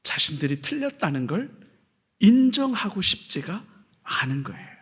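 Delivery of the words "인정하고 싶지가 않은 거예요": hushed whisper. Real, not acted. Preacher confiding the Pharisees' anger, whispered. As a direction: breathe it out secretly.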